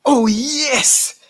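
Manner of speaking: high joyful shout; excited cry. Real, not acted. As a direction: cheer it out. Celebrating the chant.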